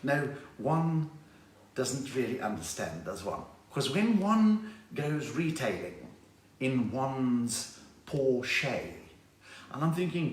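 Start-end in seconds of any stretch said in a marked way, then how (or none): 0.0-8.8 put-on slow, drawn-out southern suburbs Cape Town accent; low, stretched upper-crust Cape Town speech; above-the-line Cape Town accent. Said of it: "oaks from above the line in Cape Town".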